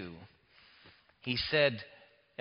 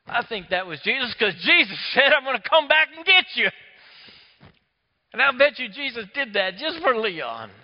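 higher and louder, fervent sermon voice; preaching; 10 semitones higher, 11 dB louder than usual. Acted. Preacher proclaims Jesus's promise to the congregation, fervent preaching. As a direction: preach it with full fervor.

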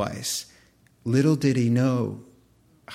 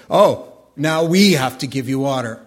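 loud, mocking sarcastic outburst; sarcastic Moses; 7 dB louder than usual. Moses scornfully shouting at the people, sarcastic. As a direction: hurl it back at them with sarcasm. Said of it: "he said".

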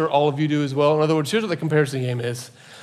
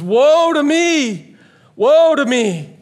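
loud, high, drawn-out lament; anguished prophet's cry; 10 semitones higher, 7 dB louder, much slower than usual. Acted. Prophet overwhelmed by God's glory, anguished cry. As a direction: cry out woe, stretching the words high and loud.

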